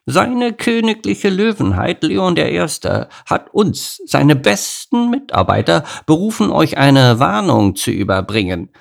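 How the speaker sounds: mock royal herald; haughty bark. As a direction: puff up self-important. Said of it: "bellte Henriette hochmütig".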